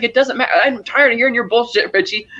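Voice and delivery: high-pitched retold exasperation; fed-up retort. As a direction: lightly act out losing patience. Acted quarrel, fed-up brother.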